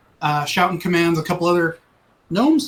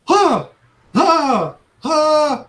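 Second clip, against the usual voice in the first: 1 panting gasps; 8 semitones higher, 4 dB louder, much slower than usual. Acted. Gnome bolts up, panting.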